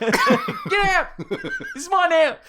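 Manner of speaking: high, loud mock parrot with a laugh, slowing down; bird voice. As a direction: play the parrot shoving the driver out, cackling. Parrot stealing a car.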